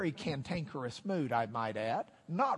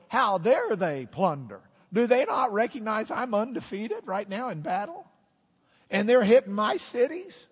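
louder, higher, puffed-up outrage; indignant boastful king; 5 semitones higher, 7 dB louder than usual. Acted. King fuming at Israel plundering his cities, building indignant boast. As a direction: play a cocky king working himself up to a threat. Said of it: "King Amaziah is thinking".

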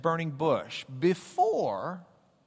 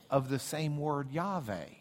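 ordinary talk

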